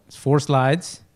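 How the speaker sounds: ordinary talk, nothing marked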